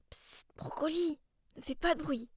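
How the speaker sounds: hushed whisper to the dog; whispering while hiding. Acted. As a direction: whisper so nobody hears. Hiding from grandmother.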